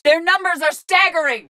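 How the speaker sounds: high-pitched battle cry of alarm; alarmed callout. Acted. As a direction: call out over a firefight. Combat callout, outnumbered.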